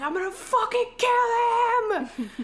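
hammed-up rage at the friend; mock furious scream. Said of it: "He starts screaming".

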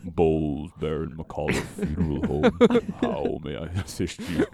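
slow, low spooky undertaker voice; ghoulish voice. Shown as a demonstration. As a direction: drag it out like a ghoul. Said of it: "I'll do".

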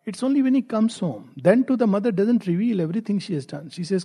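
usual speaking voice

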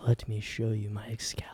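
hushed whisper. Acted. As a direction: breathe it as a whisper. Whispered joke line.

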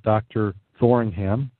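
usual speaking voice

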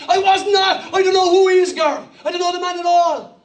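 high, worked-up Cork denial; Cork accent. Acted. Peter losing it at the servant girl, high-pitched Cork accent. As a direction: lose it in a put-on Cork voice. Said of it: "Peter loses his life".

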